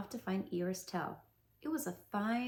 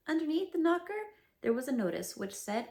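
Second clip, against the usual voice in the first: plain reading voice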